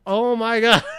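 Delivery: high, loud mock amazement; hammed-up shock of a sudden realization; acted astonishment. Reacting to meeting his friend's wife, mock astonished.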